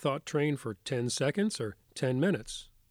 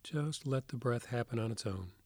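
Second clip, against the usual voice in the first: soft spa whisper; 4 dB quieter than usual. Acted. Leads a guided meditation.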